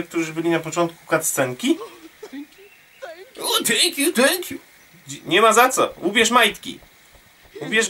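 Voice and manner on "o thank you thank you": high put-on thankful voice; voicing grateful captive. Freed captive thanking the rescuer, high put-on voice. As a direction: squeak thanks as a freed prisoner.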